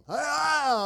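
high, drawn-out mock scream; sudden high wail, imitating her; imitated yell. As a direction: mimic her yelling out. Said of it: "she'd start yelling".

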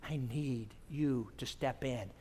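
low, soft, slow imitated prayer; earnest plea. Woman asks God to step in, hushed plea. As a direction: draw it out quietly, imitating her prayer.

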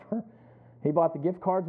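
usual speaking voice

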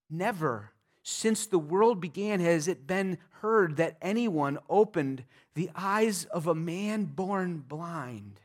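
ordinary talk, nothing marked

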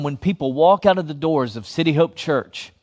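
ordinary talk

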